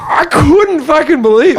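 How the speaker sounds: high-pitched incredulous outburst; exasperated disbelief. Real, not acted. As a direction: let your voice climb in sheer disbelief. Recalls disbelief at seatmate.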